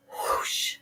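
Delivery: breathy rushing whoosh; whispered whoosh sound. A narrator's reading. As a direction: let the air rush out. Sound effect in story.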